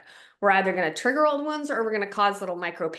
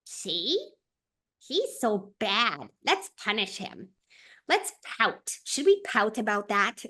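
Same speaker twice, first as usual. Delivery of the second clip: sulky inner part; slower than usual. Retold or imitated. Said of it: "one of them being like".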